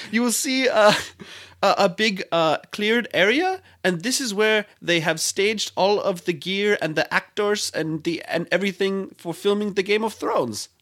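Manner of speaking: high, careful mock Germanic-Icelandic guide; imitated guide's accent, Icelandic gone German; Icelandic accent turning German. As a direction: explain like a tour guide with a vaguely German accent. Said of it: "it's kind of German now".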